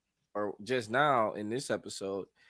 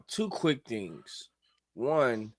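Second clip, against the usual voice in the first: usual speaking voice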